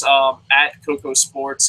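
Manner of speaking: ordinary talk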